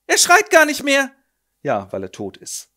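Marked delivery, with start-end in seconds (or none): 0.1-1.1 high, loud dread; a mother realising the worst; horrified cry. Acted. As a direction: cry out in horror. Mother notices silence.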